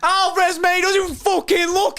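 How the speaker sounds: thrilled high shout; excited shouting. Real, not acted. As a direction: keep yelling in delight. Still celebrating goal.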